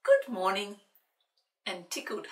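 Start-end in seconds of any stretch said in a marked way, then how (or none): 0.0-0.8 loud, hearty gentleman's greeting; Sir Reggie greeting. Acted. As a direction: boom a cheery good morning. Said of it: "Sir Reggie smiled and said".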